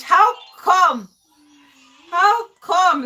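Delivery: loud, high "how come"; exclaimed disbelief. Real, not acted. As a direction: cry out in exasperated disbelief. Mock outrage at her poor French.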